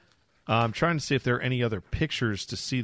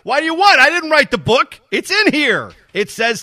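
high, loud indignant denial; hammed-up outrage, loud and high; loud mock protest; 13 semitones higher, 8 dB louder than usual.

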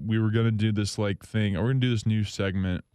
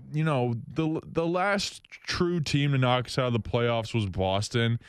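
normal talking voice

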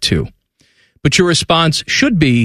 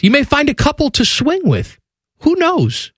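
mock enticing pitch; 11 semitones higher than usual. Teasing swinger pitch in ad.

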